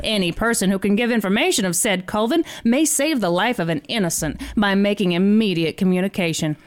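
put-on old-timey newsreel voice, mid-Atlantic. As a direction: read it like a 1930s newsreel. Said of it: "should I do it in an old-timey voice".

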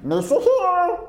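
high silly alien voice; squeaky Gungan voice; Jar Jar Binks voice. Pretend. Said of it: "I do a really good Jar Jar Binks".

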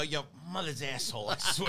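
a hint of a pissed-off Queens guy, laughing at the end; mock angry Queens accent. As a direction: grumble it like an annoyed Queens local. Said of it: "if I'm really pissed off".

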